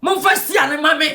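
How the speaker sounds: ordinary delivery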